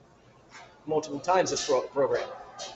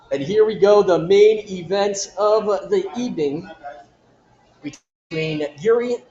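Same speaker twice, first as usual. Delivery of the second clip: big, slow fight announcer call; 4 dB louder, slower than usual. Acted. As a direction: draw out the intro like a ring announcer. Announces the main event.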